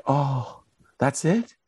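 high, light mother's voice, lightly imitated; small high retold mom reaction; mom's relieved reply. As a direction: play a mother making little of bad news. Mom's unbothered reply, acted out.

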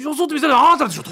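quick exaggerated punchline delivery; hammed-up accusation. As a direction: race into a mock-accusing あなたでしょ. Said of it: "言い方やんか、".

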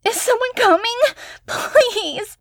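sobbing. Acted. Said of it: "She was sobbing loudly".

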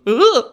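high, loud comic yelp; short squeal imitating a helpless rider; imitated little whimper. Pete unable to control a horse, imitated whimper. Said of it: "having little whimpers of".

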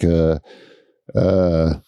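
ordinary delivery

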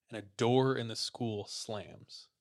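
usual speaking voice

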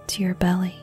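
usual speaking voice

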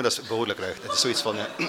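ordinary talk, nothing marked

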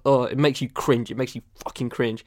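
ordinary delivery

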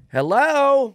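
showman cane entrance. Pretend. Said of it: "I use the cane and I go".

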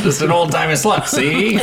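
fast-talking 1930s newsie, see; 1930s newspaper man. Pretend. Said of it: "nineteen thirties newspaper man voice".

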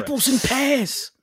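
slow, high-pitched mock Cockney; Cockney accent. Co-host imitating an actor's Cockney phrases, slow Cockney accent. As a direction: draw it out in a comic Cockney accent. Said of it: "Cockney phrases is just the funniest thing".

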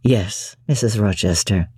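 deep, low, doting voice; deep voiced master, softly adoring; Rochester, fond. A narrator's reading. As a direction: croon it low to his bride. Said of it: "said he".

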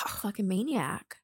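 slow, soft muttered insult. Retold or imitated. Ex muttering to his mom, softly insulting her. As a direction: drag it out under the breath. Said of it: "and he'd go".

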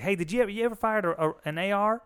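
put-on high, quick Southern kid voice; Southern accent. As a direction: imitate an eager little kid with a Southern accent. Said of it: "give him a Southern accent".

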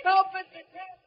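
high desperate cries for help; calling for help. Acted. Boy trapped in the flooding room cries out to Mister Kent, high and desperate. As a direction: yell out to be rescued.

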